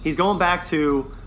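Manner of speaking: normal talking voice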